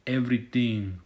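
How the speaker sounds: put-on a rough, half-hearted Nigerian accent, slowed on the threats; mock Nigerian accent. Vlogger quoting the Nigerian scammer's threats, in a mock Nigerian accent. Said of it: "Nigerian accent".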